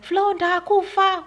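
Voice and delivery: high, loud, drawn-out Shona cry; retold yell. Attacked woman crying to Flo for help, loud retold yell. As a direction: re-enact yelling for help in Shona. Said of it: "yell and I say something in Shona".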